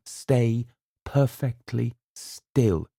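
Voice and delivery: slow, steady calming order; calm command. A narrator's reading. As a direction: keep her still, calm. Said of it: "he said".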